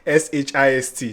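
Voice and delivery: S H I S T said letter by letter; name each letter of S H I S T separately; spelled out. Shown as a demonstration. Host spelling the name for viewers, S H I S T letter by letter.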